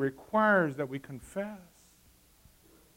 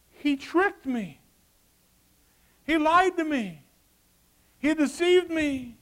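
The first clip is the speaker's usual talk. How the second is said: whiny excuse; 11 semitones higher, 7 dB louder, slower than usual. Retold or imitated. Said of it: "Eve's claim".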